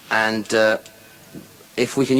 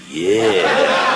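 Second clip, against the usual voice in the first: laughing yeah; 6 semitones lower, 5 dB louder than usual. Acted. Third woman's answer.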